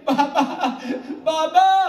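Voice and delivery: loud weeping call of بابا, stretched and high; sobbing cry. Acted. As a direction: cry out بابا twice as a grieving son. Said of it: "رو کر کہنے لگے". (روتے ہوئے بابا پکارنا)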